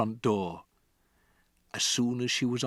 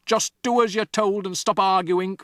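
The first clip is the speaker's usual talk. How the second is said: loud, sharp angry dad; snapping father; 9 semitones higher, 7 dB louder than usual. Acted. Father snapping at Matilda, loud and angry. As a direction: cut her off hard. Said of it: "the father snapped".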